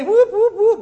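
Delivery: high imitated siren whoops; alarm whoop. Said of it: "Alarms going off everywhere".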